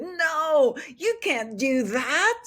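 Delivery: high, drawn-out mock outcry of objection; retold shocked protest. Grown children's retold shocked objection.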